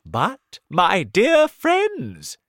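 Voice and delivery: high, loud call to friends; excited cry. A narrator's reading. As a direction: cry out, drawing out the words. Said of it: "cried the old green grasshopper".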